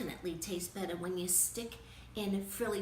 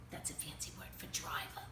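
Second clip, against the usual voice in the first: unvoiced, softer definition; whispered aside; 5 semitones lower, 8 dB quieter than usual. Reader explaining a word to kids, whispering. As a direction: murmur it as a side note.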